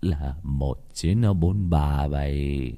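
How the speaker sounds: low, very slow, drawn-out answer; sorrowful old man. Acted. Widower answering his son, low slow grief. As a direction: drag the words out low and wearily.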